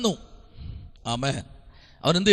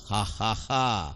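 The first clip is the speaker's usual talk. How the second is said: slow, low, soft mock "ha, ha, ha"; mocking laugh; 10 semitones lower, 8 dB quieter, much slower than usual. The crowd ridiculing Jesus, slow mocking laugh. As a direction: spell out a derisive laugh, slowly and quietly. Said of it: "അവരോ അവനെ പരിഹസിച്ചു".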